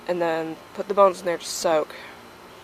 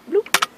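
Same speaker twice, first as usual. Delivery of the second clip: playful bloop; 13 semitones higher, 6 dB louder than usual. Real, not acted. Bones dropped in water.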